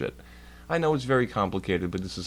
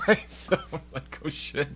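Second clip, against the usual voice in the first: high breathy laughing through words; 7 semitones higher, 6 dB quieter than usual. Real, not acted. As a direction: laugh while you tell it. Laughing while retelling.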